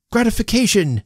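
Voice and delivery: high-pitched supplied word; helpful prompter. A narrator's reading. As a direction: prompt the stuck speaker. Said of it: "suggested the friend of the family".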